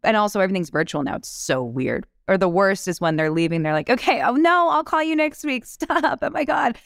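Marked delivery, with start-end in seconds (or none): 3.9-6.8 much higher, giggly mock of casting people laughing as you leave; mocking giggly casting panel. Auditioner imitating panel laughing behind thin walls, high and giddy. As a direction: toss it off high and giddy like a panel having fun. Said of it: "The laughers that would come out".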